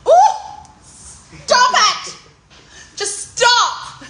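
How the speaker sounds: high loud delighted squeal, waving off praise; squealing stop it. Real, not acted. Overwhelmed by compliments, squealing.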